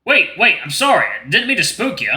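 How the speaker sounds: high, hurried stranger apologizing; alarmed, apologetic character voice; worried apology. Acted. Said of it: "his worried expression".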